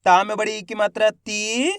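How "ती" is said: "ती" with a long stretched ee, rising; drawn-out vowel. Shown as a demonstration. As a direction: stretch the vowel of "ती" as a reading demo. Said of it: "खींच के पढ़ेंगे".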